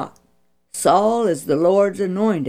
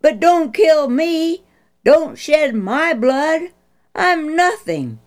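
earnest plea; 8 semitones higher, 4 dB louder than usual. Acted. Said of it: "David's earnest plea".

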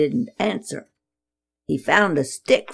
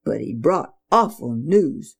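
ordinary delivery, nothing marked

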